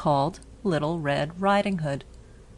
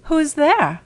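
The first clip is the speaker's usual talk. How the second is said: put-on high, loud old grandmother calling out; frail grandmother asking who knocks; grandmother's voice; 10 semitones higher, 6 dB louder than usual. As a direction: call from the sickbed as an old woman. Sick grandmother answers the door.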